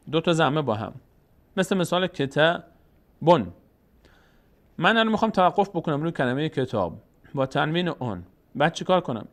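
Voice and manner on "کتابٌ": کتابون slowly, final "bon" set apart; tanween "on" sounded. Shown as a demonstration. Teacher gives an example of damma tanween, کتابون ending in clear "-bon". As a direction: break کتابون into ketā, then "bon". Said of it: "تنوین اون بود".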